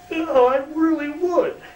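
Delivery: very high, eager voice; Carl's voice. Retold or imitated. Carl insisting to Kerry he wants to, very high. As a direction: pitch it way up, keen to please.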